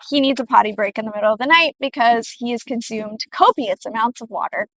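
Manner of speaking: ordinary talk, nothing marked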